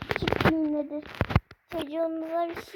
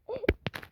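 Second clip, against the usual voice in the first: a higher, sobbing catch of breath; crying gasp; 6 semitones higher, 4 dB quieter than usual. Pretend. Daughter after the shot, gasping as if crying. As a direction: gasp like a child still crying.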